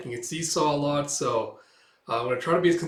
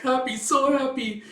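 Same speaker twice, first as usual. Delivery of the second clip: much higher, slow, gushing; high drawn-out happy thought; gleeful inner voice; 11 semitones higher, 5 dB louder than usual. Acted. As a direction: echo her joy dreamily. Anya reads Melinda's mind.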